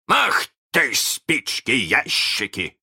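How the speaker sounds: high, slow mimicked curse; Captain Hook. A narrator's reading. Peter mimics Hook's curse, high slow voice. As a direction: copy the captain's drawn-out oath. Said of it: "ответил голос".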